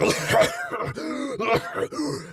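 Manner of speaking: long, high choking coughs; suffocating cough. Shown as a demonstration. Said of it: "I started coughing".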